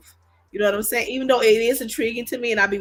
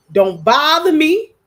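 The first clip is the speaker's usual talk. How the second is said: louder, slow, playfully bossy; mock stern warning; 5 semitones higher, 9 dB louder, slower than usual. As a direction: lay down the law, but only joking. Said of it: "No, I'm just playing".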